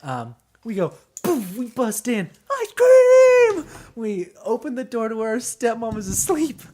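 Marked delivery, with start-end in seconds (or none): 2.5-3.6 put-on high, loud kid's cry of joy; excited shout. Kids bursting into the house with their prize, shouting. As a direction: burst in yelling with glee.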